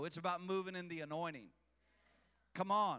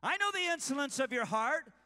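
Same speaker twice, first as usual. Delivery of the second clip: raised, intense scripture reading; loud dramatic reading; 6 semitones higher, 7 dB louder than usual. Acted. Preacher reading Eliab's angry rebuke aloud. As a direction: read it like the scene is live.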